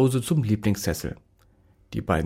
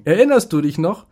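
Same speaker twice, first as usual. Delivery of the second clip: higher, louder playful question; lightly voiced playful doctor; teasing doctor; 6 semitones higher, 7 dB louder than usual. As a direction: vet gently ribbing the dog.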